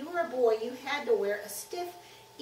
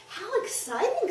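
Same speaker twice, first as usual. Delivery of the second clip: put-on high-pitched excited child; girl eagerly relishing a mystery; thrilled; 11 semitones higher, 4 dB louder than usual. A narrator's reading. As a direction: exclaim with delight at the mystery.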